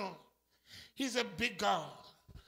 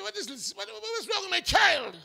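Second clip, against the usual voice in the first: rising to a higher shout; God scolding a doubting child; exasperated father; 7 semitones higher, 7 dB louder than usual. Acted. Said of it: "I said".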